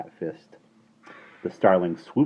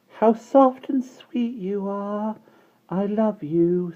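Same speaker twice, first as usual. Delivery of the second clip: put-on high, slow, dreamy baby; baby girl voice; 4 dB louder, much slower than usual. A narrator's reading. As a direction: coo to the sunbeam like a little baby.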